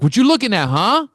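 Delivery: loud, high, hostile street challenge; hammed-up Brooklyn tough guy snapping at a stranger; angry Brooklyn guy. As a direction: bark it like a tough guy picking a fight. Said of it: "He's like".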